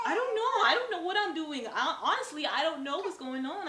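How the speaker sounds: high, loud laughing panic; laughing through the panic; flustered laughing. Retold or imitated. Retelling panic during labor.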